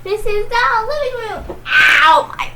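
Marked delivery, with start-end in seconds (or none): 1.7-2.5 sudden pained ow; yelping about a sore back; hurt back. Real, not acted. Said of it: "that hurt my back too".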